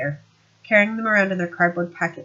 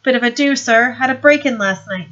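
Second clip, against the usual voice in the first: higher, louder smirking sergeant; Knock's voice; 5 semitones higher, 9 dB louder than usual. Acted. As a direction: play the sly sergeant, pleased with himself. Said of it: "done Knock or his voice".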